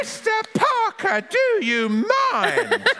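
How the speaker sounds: very high, drawn-out scandalised granny; hammed-up high-pitched outrage; mock horror. Granny caught in state of undress, high mock horror. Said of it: "said Granny in mock horror".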